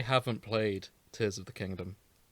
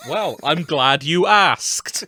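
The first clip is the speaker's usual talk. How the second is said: slow, loud, grand Shatner delivery; Shatner impression; 4 semitones higher, 12 dB louder, slower than usual. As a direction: draw it out like a dramatic captain. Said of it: "doing a Shatner impression".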